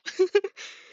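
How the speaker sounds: sudden burst of high laughter; loud laugh. Real, not acted. Laughs at the situation.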